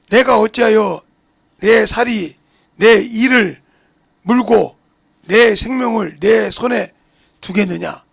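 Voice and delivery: slow, weighty reading of a Bible verse; solemn, drawn-out recitation of Job; scripture reading. Acted. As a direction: read each phrase slowly, like scripture from the pulpit. Reads Job scripture aloud.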